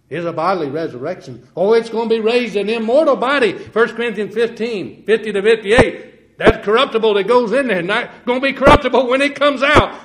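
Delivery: high, loud preaching cadence; rising revival sermon voice; fervent preaching. Acted. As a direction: lift it into full fervent preaching. Preaching at full force.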